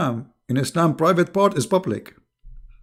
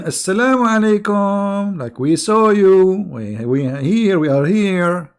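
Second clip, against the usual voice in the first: higher, louder, slow, pointed "We saw you"; menacing greeting; 6 semitones higher, 4 dB louder, slower than usual. Shown as a demonstration. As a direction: drag out each word like catching a criminal. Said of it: "somebody committing a crime".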